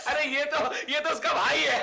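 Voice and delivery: high, loud exclamation; astonished. Acted. Husband learning who wife walked with, loud astonishment. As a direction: blurt it out in amazement. Astonished at supposed lover's identity.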